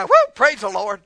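loud whoop of praise; mock celebration. Preacher playfully celebrating death, mock-excited. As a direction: whoop as if dying were great news.

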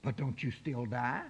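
normal talking voice

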